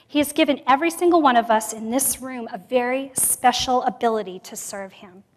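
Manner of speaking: usual speaking voice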